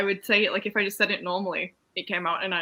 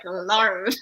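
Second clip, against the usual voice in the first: "Lord" drawn out in mock American; American accent. Amused friend repeating the funny word, mock American accent. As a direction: imitate an American saying "Lord". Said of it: "that don't work in American".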